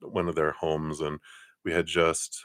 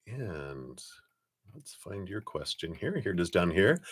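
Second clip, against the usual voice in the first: normal talking voice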